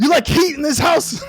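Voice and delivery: high, loud sing-song mock yell; mock shout. Host mock-yells at his wife about heating, hammed-up. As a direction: belt it like a blustering provider. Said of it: "host acts out yelling at wife".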